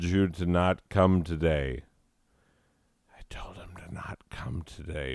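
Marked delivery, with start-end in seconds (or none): 3.2-5.1 unvoiced aside whisper; stage whisper. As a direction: whisper it behind your hand. Aside whisper about Ian.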